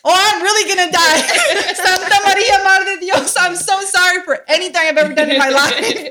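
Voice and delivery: high, loud laughing plea to the saints; laughing through an overblown deathbed prayer; mock dying prayer. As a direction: ham up a panicked girl begging forgiveness. Retelling mother crying, fear.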